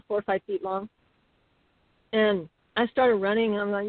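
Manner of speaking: ordinary talk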